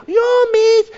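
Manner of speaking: put-on high, squeaky kid shouting me; a child bursting to answer first; eager child's cry. Child eager to answer the teacher, high squeaky yell.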